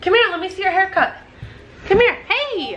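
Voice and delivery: high, playful mom voice. Real, not acted. Mom calling her son over for a look, coaxing. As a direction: coax a little one over in a lifted pitch. Coaxing young child to show haircut.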